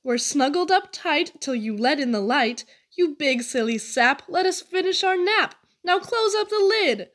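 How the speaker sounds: high, squeaky little fish voices, annoyed at being woken; squeaky sardines. A narrator's reading. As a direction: squeak it out like cranky sleeping sardines. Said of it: "they started to squeak".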